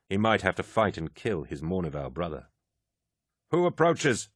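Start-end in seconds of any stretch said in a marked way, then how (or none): 3.5-4.3 hidden guard voice. Acted. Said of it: "asked a voice from the darkness".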